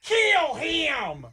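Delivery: high, strained bloodthirsty mock yell; drawn-out screech of a rabid fan; imitated scream. Co-host mimicking Lucas yelling at the quarterback, high screech. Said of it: "as he screams".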